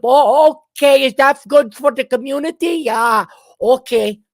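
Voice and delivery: loud, high, eager Minnesota dude; Minnesota accent. Retold or imitated. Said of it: "Every Minnesota dude would line up".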